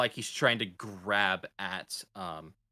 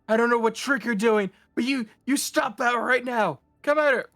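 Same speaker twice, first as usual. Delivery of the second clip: panicked yelling sailor; 12 semitones higher, 6 dB louder than usual. Acted. Sailor shouts at the ghost.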